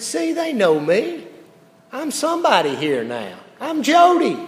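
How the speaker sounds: high, boastful mock pride; smug self-importance. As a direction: puff up proudly, playing it for laughs. Proudly acting out his own thoughts.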